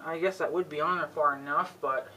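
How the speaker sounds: normal talking voice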